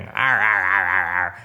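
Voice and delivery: mimicking a gruff sailor's growl; pirate growls. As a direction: growl "arr" like a hammy old sea dog. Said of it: "it's basically Willem going".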